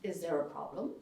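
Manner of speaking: detached German matter-of-factness, softly; clinical German. Retold or imitated. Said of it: "in a really German way".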